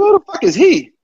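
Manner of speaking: high and loud, offended at being called he; indignant outburst. Retold or imitated. Player snapping back at coach in front of teammates, high and indignant. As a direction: snap back in disbelief.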